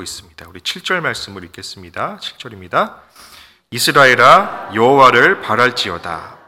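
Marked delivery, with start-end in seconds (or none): 3.7-6.4 slow, ringing call to Israel, much louder; loud scripture proclamation. Acted. Pastor proclaiming the psalm to the congregation, slow and loud. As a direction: proclaim the verse slowly with full force.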